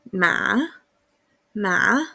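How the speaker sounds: MA dipping down, then rising; Ma in a drawn-out dip and rise; third tone. Shown as a demonstration. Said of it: "yo parto en un punto alto, bajo".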